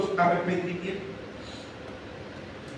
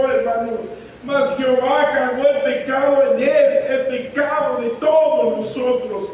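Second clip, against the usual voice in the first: fervent preaching; 4 semitones higher than usual. Acted. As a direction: pound the verses home to the congregation. Preaches at full force.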